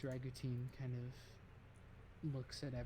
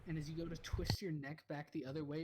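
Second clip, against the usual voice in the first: usual speaking voice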